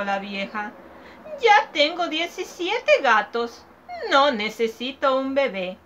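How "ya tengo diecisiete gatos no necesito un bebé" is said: higher, louder fussy refusal; old woman turning the child away; old woman refusing. Acted. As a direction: dismiss him like a fussy old lady.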